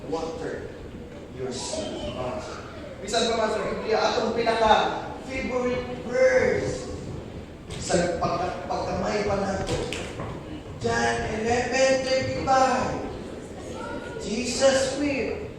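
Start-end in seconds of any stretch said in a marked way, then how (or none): 0.0-1.9 dropping low and soft; hushed preaching. Real, not acted. Sudden quiet after shouting. 10.8-15.5 each word drawn out, slow and soft; slow solemn reading. Real, not acted.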